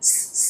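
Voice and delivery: "স, স" as two pure voiceless hisses; quiet sibilant bursts on "স, স", no vowel; hissing s sound. Shown as a demonstration. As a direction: hiss a bare s twice for "স, স". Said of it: "এরকম একটা sound হবে".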